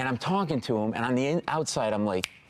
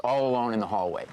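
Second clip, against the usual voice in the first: normal talking voice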